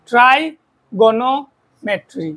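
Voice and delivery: Trigonometry drawn out very slowly, syllable by syllable; slow syllable demo. Shown as a demonstration. Teacher shows students the syllables of trigonometry, said very slowly. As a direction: stretch trigonometry so each syllable is heard. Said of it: "আমরা তোমার syllable যদি দেখি".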